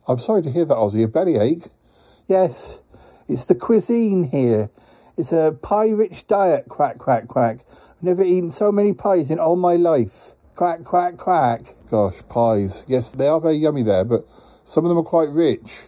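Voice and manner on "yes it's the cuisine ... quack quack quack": lightly voiced, quack-punctuated character; rueful quacking creature. Quacking visitor blames the rich pies, lightly rueful creature voice. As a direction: play a mildly complaining quacking creature.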